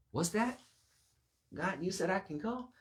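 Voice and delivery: high, loud, hammed-up delighted question; eager pretend hearing. Man pretending God said he can go, eager. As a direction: act suddenly thrilled at a supposed permission.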